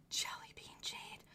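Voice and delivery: hushed, breathy whisper of awe; awed whisper to the camera. Real, not acted. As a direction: whisper it like a secret treasure. Admiring a jade carving.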